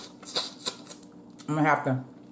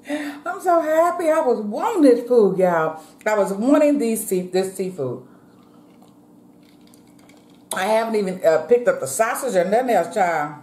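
excited; 6 semitones higher than usual. Real, not acted. Overjoyed over the seafood.

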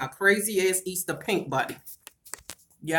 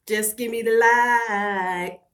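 drawn-out plea; much slower than usual. Real, not acted.

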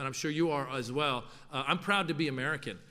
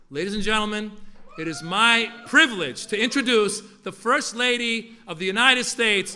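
louder, building to a high shouted name; announcer introduction; 6 semitones higher, 12 dB louder than usual. Acted. Introduces the First Lady.

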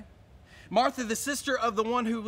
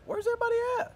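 puzzled; 14 semitones higher, much faster than usual. Retold or imitated. Puzzled retold self.

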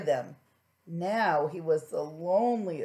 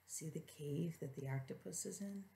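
gentle hushed comment; soft aside; 2 semitones lower, 15 dB quieter than usual. Real, not acted. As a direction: quietly point out the picture. Aside to child.

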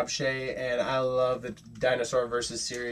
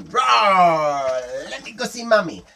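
eager, raised little-dinosaur voice; 4 semitones higher, 6 dB louder, slower than usual. Acted. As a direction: roar loud, then lift the pitch. Little dinosaur roars in story.

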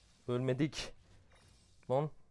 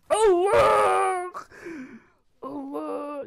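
high, loud shout; excited cry; 12 semitones higher, 9 dB louder, much slower than usual. Real, not acted. Reacting to a sudden turn in the fight, loud cry. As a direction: burst out at the play.

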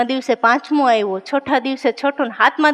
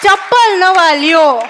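slow, louder, higher mock mechanic; mechanic's punchline; 4 semitones higher, 5 dB louder, slower than usual. Mechanic telling the woman to buy new slippers, slow and loud. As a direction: drag out the advice like a deadpan mechanic. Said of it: "ઓલા મિકેનિકે કીધું કે".

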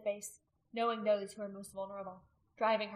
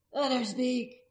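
forceful, raised call; loud demand; 4 semitones higher, 7 dB louder, slower than usual. A narrator's reading. Men insisting the woman be heard, loud demand. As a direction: call out for her to speak. Crowd demands she speak.

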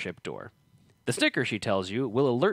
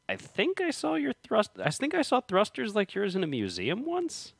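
high character voice; 11 semitones higher than usual. Acted. Mechanic character voiced in story.

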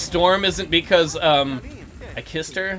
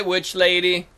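ordinary talk, nothing marked

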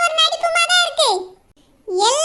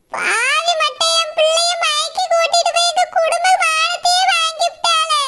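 ordinary talk, nothing marked

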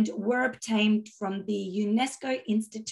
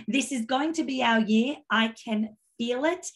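usual speaking voice

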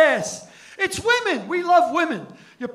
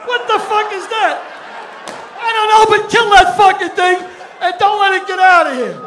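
loud, higher alarmed yell; mock panicked men; 5 semitones higher, 5 dB louder than usual. Comic playing scared men at the stage, loud mock alarm. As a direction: shout like you've seen a monster.

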